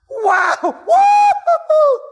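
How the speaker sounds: high shouted whoop; excited whoop. Acted. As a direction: let out a big loud whoop. Acts excited greeting again.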